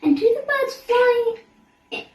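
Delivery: enchanted by the picture of birds; giggly sing-song question; delighted question. A narrator's reading.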